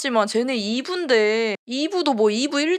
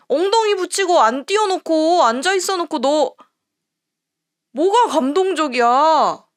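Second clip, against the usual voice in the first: 혈압 오른 분노; 3 semitones higher, 3 dB louder than usual. Real, not acted. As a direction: let the blood pressure rise into a cursing outburst. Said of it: "나 저거 보고 혈압이 확 올라가네". (혈압이 확 오른 듯한 격앙된 질타)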